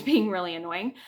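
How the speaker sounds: ordinary talk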